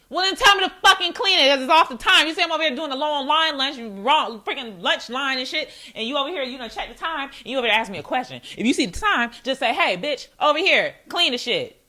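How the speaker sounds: loud, high-pitched indignant tirade; irate worker rant. Acted. Lunch-line worker snapping at a manager's question, irate rant. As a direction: let the boss have it, loudly. Acted angry reply to manager.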